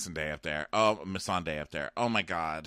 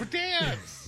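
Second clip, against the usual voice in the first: high, far-off hammed-up order to dance; mock command. Podcaster mocking Cersei ordering the tiny squad to dance, high and showy. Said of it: "I was like".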